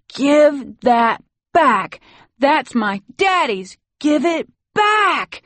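put-on high, loud, drawn-out child's tantrum; blast of rage. A narrator's reading. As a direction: scream it like an enraged small boy. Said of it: "He gives forth a blast of rage".

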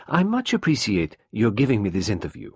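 plain reading voice

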